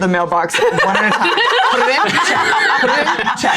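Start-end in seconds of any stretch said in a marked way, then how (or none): 1.7-3.6 high, fussy checking voice; fussy sing-song. Acted. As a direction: chant each step like a fussy clerk. Mocking fussy mail sorting.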